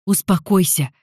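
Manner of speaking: firm order. Acted. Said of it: "велел мне Джереми".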